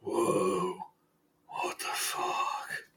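hushed, slow disbelief; stunned whisper. Retold or imitated. Crowd staring at the hawk, whispering in awe. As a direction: breathe it out in shock. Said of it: "looks at it, like".

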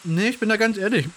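talking through a mouthful of biscuit; chewing while talking. Real, not acted. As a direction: eat a cookie while you talk. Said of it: "ich esse nebenbei einen Keks".

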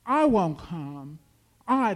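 loud, emphatic refusal short of a scream; screamed refusal, as narrated. A narrator's reading. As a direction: push each refusal out forcefully. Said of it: "She screamed".

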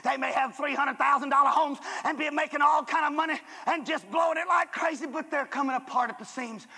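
high and loud, shouting the call; full-force preaching. Acted. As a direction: preach it to the back row.

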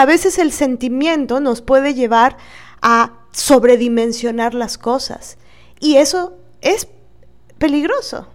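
ordinary talk, nothing marked

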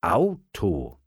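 drawn-out, thinking aloud; pondering. A narrator's reading. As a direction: mull the word over, stretching it. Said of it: "Thomas dachte nach". (nachdenklich gedehnt)

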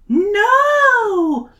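long, very high, loud wail; pleading cry. Acted. Emily refusing to be sent away, wailing plea. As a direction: draw it out as a hammed-up plea. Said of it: "Emily pleads".

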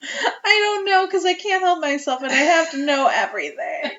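hammed-up tearful confession, easing toward laughter; mock wailing. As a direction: wail helplessly as if about to cry. Comic helpless confession when challenged.

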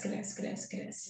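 whispered repeats dying away to nothing; fading whisper. Real, not acted. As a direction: let each cresce fade into a whisper. Growth trailing off. (cresce sussurrado, sumindo aos poucos)

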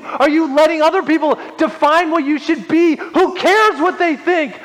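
high, impassioned preaching at full force. Real, not acted. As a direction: preach it urgently, pitch held high.

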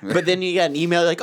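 ordinary talk, nothing marked

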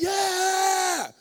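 high, loud cheering "Yeah"; dad cheering his son; proud dad. Retold or imitated. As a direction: whoop like a proud father. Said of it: "He's like".

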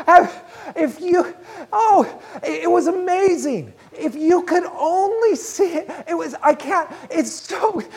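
high, loud, breaking into overjoyed laughter; joy-filled laughter. Retold or imitated. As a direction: act out someone overcome with joyful amazement, struggling to get words out. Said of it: "one of the most joy-filled laughters".

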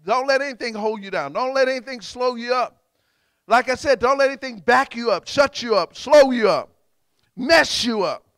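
loud, high preaching in punchy phrases; fiery exhortation. Real, not acted. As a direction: drive each phrase home at full force. Preacher exhorts congregation at full force.

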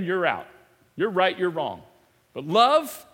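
ordinary talk, nothing marked

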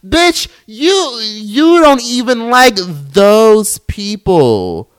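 high, loud, very slow outraged shout; real angry yell; furious shout. Real, not acted. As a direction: drag it out in disgust, yelling. Ranting at Catherine.

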